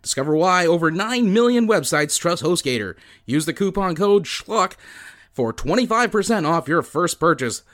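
brisk upbeat sponsor read; fast radio announcer voice. Acted. Reads sponsor ad.

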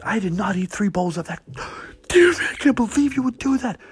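high-pitched, loud outraged protest; hammed-up horrified diner with a gasp; mock indignant woman. Offended diner protests in story.

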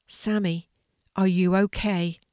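ordinary delivery, nothing marked

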